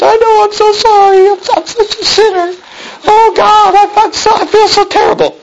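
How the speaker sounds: high-pitched hammed-up sobbing; mock crying. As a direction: wail it like a fake tearful sinner. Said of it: "she starts crying and says".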